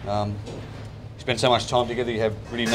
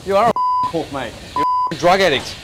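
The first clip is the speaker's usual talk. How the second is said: angry; 6 semitones higher, 8 dB louder than usual. Real, not acted. Chef abusing a supplier.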